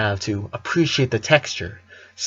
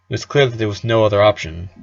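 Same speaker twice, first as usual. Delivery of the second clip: usual speaking voice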